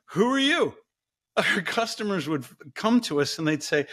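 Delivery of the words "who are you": high-pitched mock puzzlement; baffled dismissal. Google not recognizing the company, mock bafflement. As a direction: question a total stranger, pitch leaping up. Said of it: "Google was like".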